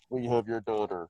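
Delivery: low, muffled kidnapper voice; disguised voice on a TV show. Retold or imitated. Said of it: "they have the muffled voice".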